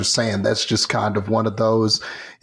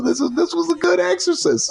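high, hammed-up admiring exclamation; mock gushing; faster than usual. Watching the actor's performance, high mock-gushing praise. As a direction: gush over him like a starstruck fan. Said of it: "And I was like".

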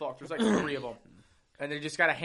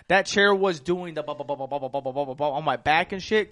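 massage chair noise; 5 dB louder than usual. Retold or imitated.

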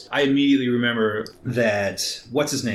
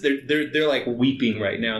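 usual speaking voice

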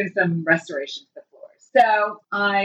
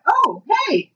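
high bright greeting; 11 semitones higher than usual. Retold or imitated. Opening door to stranger.

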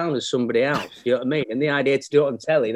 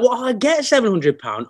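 higher, louder burst of mock glee; retold delight; 8 semitones higher, 4 dB louder than usual. Young actor learning of his fee, playfully excited. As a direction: act out being thrilled with the money.

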